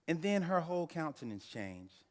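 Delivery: usual speaking voice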